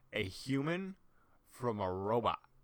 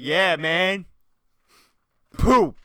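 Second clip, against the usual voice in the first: loud taunting; 6 semitones higher, 13 dB louder, slower than usual. Retold or imitated. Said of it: "and you're like".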